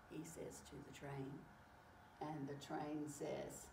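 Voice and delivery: ordinary delivery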